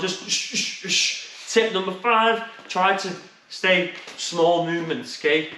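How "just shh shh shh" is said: quick unvoiced shh breaths; sharp breaths with punches. Shown as a demonstration. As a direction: hiss out short breaths like punching. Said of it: "Doesn't matter how silly it sounds".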